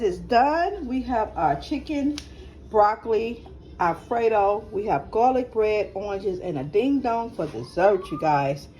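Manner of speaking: usual speaking voice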